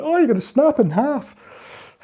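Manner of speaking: high, drawn-out cry of mock alarm. Watcher reacting to a rower's bad technique, mock alarmed. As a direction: exaggerate the horror, high and slow.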